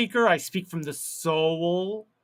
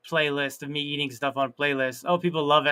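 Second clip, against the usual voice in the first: ordinary talk, nothing marked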